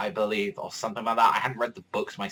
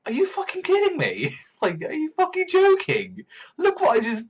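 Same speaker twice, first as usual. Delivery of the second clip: much higher, laughing through the disbelief; laughing incredulous Tyrion; 4 dB louder than usual. Retold or imitated. Tyrion mocking the praise: high laughing disbelief. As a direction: play Tyrion cracking up in disbelief. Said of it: "'cause Tyrion's like".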